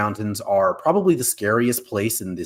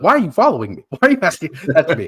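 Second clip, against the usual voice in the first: higher, louder pestered protest; mock exasperation; 5 semitones higher, 6 dB louder, much faster than usual. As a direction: playfully imitate a friend fed up with being followed. Voicing the annoyed, pestered friend.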